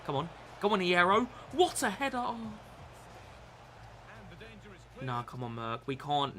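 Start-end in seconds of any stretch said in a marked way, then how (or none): 0.6-2.5 high, loud hype; roaring at a header on screen; excited cheer. Real, not acted. Cheering his player's header.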